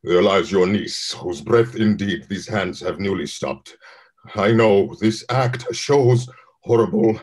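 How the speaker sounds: grim declaration. Acted. Othello shows the body.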